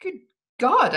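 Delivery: high, loud burst of alarm; shocked exclamation. A narrator's reading. Prisoner reacting to a threat of death, shocked exclamation. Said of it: "I exclaimed".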